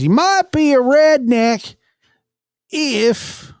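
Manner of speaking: slow, high Southern drawl; stretched-out Southern comedian voice; Jeff Foxworthy Southern accent. Retold or imitated. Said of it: "I'm gonna do his accent".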